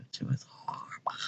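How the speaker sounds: breathy whispered aside, almost no voice. Real, not acted. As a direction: drop to a hushed whisper about her. Whispered aside about sister.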